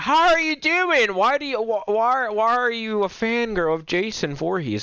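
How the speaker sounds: very high, drawn-out mock-excited squeal; mock gushing. Streamer teasing a fangirl in chat, high mock-gushing voice. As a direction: greet them in a put-on high, slow gush. Mock greeting to chatter, then joking.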